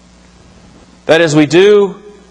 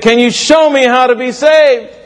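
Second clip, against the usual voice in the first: high, earnest plea; pleading; 7 semitones higher than usual. A narrator's reading. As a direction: beg him for the answer. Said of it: "he pleaded".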